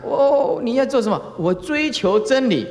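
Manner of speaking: high, eager voice of a worldly seeker; worldly person. Retold or imitated. Preacher mimics a worldly man boasting he seeks truth, high and eager. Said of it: "世间人啊， 讲".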